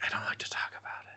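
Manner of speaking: unvoiced, shy whisper; embarrassed whisper. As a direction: whisper it, ashamed. Embarrassed whisper acted quote.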